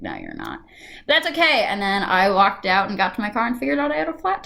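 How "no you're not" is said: very low, soft mutter; low muttered disbelief. Retold or imitated. Answering the poll worker who was glad she came, low mutter. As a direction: mutter it under your breath.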